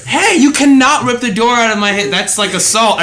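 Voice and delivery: high, loud indignant yell at the customer; retold yell. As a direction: yell it like someone is yanking the door from your hand. Door ripped, manager yelling.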